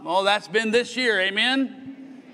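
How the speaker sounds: normal talking voice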